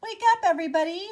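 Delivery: high, fast, loud wake-up call; cheerful wake-up call. A narrator's reading. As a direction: sing it out fast to wake them. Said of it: "Scarecrow said to his friends".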